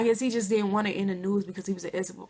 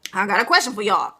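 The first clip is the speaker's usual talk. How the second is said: his way of talking; 6 dB louder than usual. Retold or imitated. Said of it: "That's how he talk".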